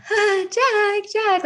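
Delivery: put-on high, drawn-out call after a runaway child. Calling after her running son.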